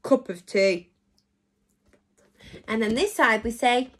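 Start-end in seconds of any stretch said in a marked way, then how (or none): 0.0-0.9 low, grumbly grumpy take; mock grumpy cup of tea. Shown as a demonstration. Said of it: "in a really, really grumpy voice".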